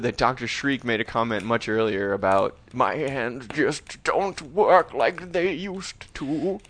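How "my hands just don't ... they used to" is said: put-on slow, quavering old piano teacher; sad old man. Old piano teacher lamenting his failing hands, slow and sad. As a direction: draw it out feebly, word by word. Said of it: "he's a sad old".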